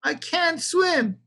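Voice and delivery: high, slow shouted wail; the hen cries out in alarm, drawn out; a high shout of panic, stretched slow; panicked cry. A narrator's reading.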